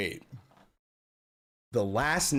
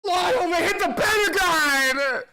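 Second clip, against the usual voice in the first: high, loud shocked yell; a real burst of alarm at the news; shocked shout; 6 dB louder than usual. Real, not acted. Breaking news shock, calls out.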